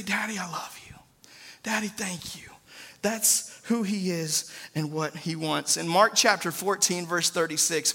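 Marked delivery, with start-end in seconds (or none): 0.0-2.5 soft, hushed words to a loving father; tender whisper. Acted. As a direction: breathe it like a child at bedtime. Child speaking tenderly to father.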